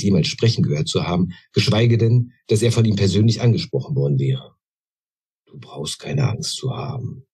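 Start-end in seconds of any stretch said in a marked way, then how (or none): 5.5-7.3 softer, slow and soothing; calm the startled little one; reassuring. A narrator's reading. Old sage calms frightened goblin. (leise, langsam und beruhigend)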